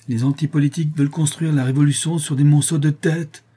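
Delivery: loud, high outburst; ranting. A narrator's reading. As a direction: rail against them. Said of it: "vociférait Manon".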